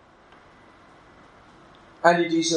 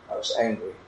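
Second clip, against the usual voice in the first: usual speaking voice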